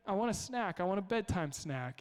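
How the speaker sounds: put-on whiny toddler. Dad retells his tired toddler begging for a snack. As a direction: beg like a tired three-year-old. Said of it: "my three-year-old, it's like".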